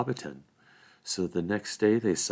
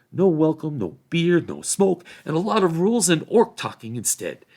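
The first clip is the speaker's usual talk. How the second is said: raised, louder country-hobbit protest; fed-up Sam; 6 semitones higher, 6 dB louder than usual. A narrator's reading. Sam breaking up the argument, fed-up protest. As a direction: put a stop to the squabble.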